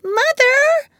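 put-on very high, loud child's call; little boy's cry. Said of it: "cried Michael".

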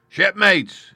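captain shouting to his crew; rallying cry. A narrator's reading. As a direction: rouse the frightened men. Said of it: "he cried".

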